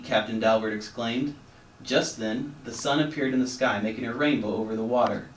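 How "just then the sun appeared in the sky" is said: usual speaking voice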